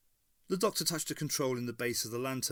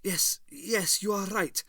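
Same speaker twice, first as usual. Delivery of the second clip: higher, eager feverish agreement; higher, hurried feverish yes; 8 semitones higher, 3 dB louder, slower than usual. A narrator's reading. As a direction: grab at the idea feverishly. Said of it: "said Hitler feverishly".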